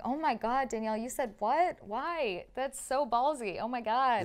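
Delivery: loud mock-shocked rant at herself; incredulous self-scolding. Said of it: "I look back and I'm like".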